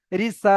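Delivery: Risa with short রি and long সা; elongated SA. Shown as a demonstration. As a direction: stretch and hold the vowel of সা in Risa. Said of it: "ঠিক এমনভাবে আপনাদেরকে করতে হবে".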